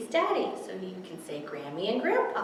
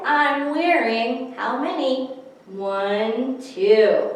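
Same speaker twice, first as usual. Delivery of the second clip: slow, bright, louder read-aloud; reading to children; 4 semitones higher, 6 dB louder, slower than usual. Acted. Librarian reading a winter picture book to toddlers, slow and bright.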